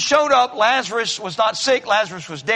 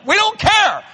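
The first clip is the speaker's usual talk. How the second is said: laughing shout; 11 semitones higher, 5 dB louder than usual. Real, not acted. Preacher mocking social media posts.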